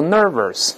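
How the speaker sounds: nervous misread as nervers, r in both syllables; wrong double r. Shown as a demonstration. Teacher warns against a mistake, nervous with r twice. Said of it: "而不要读nervous".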